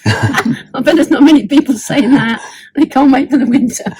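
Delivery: laughing as they speak; amused. Real, not acted. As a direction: say it through a laugh. Joking about longing for winter.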